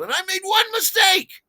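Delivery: high, loud indignant cry; shouted protest, voice shooting up; exasperated outburst. Real, not acted. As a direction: burst into a wronged yell. Exasperated outburst about unfair diners.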